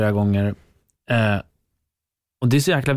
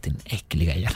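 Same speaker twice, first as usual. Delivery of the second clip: mock insult; 4 semitones lower, 5 dB quieter than usual. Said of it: "Nej, jag skojar".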